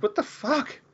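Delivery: high, hushed disbelief; stunned disbelief. Retold or imitated. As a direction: imitate a man who can't believe his eyes. Voicing the stunned hero.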